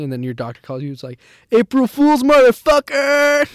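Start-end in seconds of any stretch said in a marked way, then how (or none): 1.5-3.6 very loud, high mock yell; over-the-top shouted April Fool's taunt; gleeful prank reveal. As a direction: yell the gotcha, dragging out the last word. Imagined doctor springs a prank.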